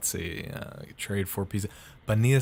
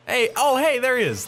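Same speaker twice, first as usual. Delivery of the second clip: excited; 11 semitones higher, 4 dB louder than usual. Real, not acted. Spots the monster in game.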